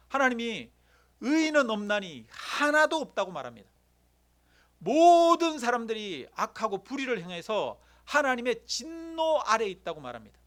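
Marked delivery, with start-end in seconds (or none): 1.2-3.3 slow, louder, higher voice of scripture; grave proclamation of scripture; solemn scripture voice. Acted. Quoting scripture with gravity. 4.8-10.0 louder, higher, slow declaration; fiery sermon climax; preaching at full force. Real, not acted.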